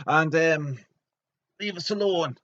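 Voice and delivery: high loud snap; hammed-up nerd yell; mock angry nerd. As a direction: yell at them to leave. Nerd snaps at player.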